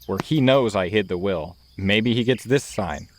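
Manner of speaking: usual speaking voice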